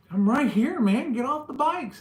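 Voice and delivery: yelling at bikers to come fight; challenging shout. Retold or imitated. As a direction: dare them to get off the bikes. Challenging bikers circling him.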